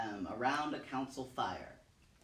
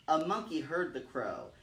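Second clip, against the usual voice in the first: ordinary delivery, nothing marked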